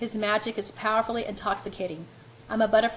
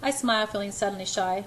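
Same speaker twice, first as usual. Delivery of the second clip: ordinary delivery, nothing marked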